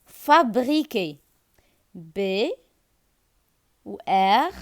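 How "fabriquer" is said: fabriquer drawn out slowly, syllable by syllable; fa-bri-quer, said slowly and clearly; slow syllable demo. Shown as a demonstration. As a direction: stretch out fabriquer for the class. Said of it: "teacher models a word".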